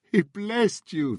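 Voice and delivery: high, slow tearful voice; men weeping over the boy in the chair. A narrator's reading. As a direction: weep it to the boy. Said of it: "they wept".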